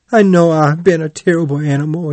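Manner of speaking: high, quick tearful wail; tearful cry. A narrator's reading. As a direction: wail it out as a weeping confession. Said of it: "he cried".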